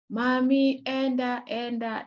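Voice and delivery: put-on child repeating a word. Shown as a demonstration. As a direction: imitate a small child echoing one word. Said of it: "you may find a child saying".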